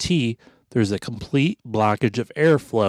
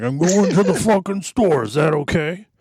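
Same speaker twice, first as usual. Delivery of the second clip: higher, snapping back at the wife; mock exasperated retort; 7 semitones higher than usual. Hard Hat retells snapping at his wife, exasperated. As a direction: bite back like an annoyed husband. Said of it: "I'm like".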